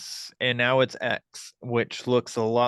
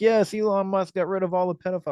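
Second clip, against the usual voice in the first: mock fan voice; 9 semitones higher, faster than usual. Mocking gullible Elon fans.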